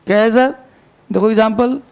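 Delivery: usual speaking voice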